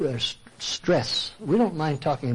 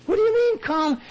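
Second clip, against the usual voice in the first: a much higher, outraged "Calm down?"; indignant echo. Retold or imitated. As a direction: throw the advice back in disbelief, pitched way up. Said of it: "And they say".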